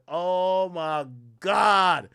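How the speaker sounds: very slow, drawn-out groan of disbelief; recalling his reaction to her excuse, drawn out; exasperated. Retold or imitated. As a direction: stretch it out, fed up.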